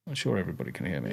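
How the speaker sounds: soft quick repeat. Shown as a demonstration. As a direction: mutter it fast and soft. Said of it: "audio cut out".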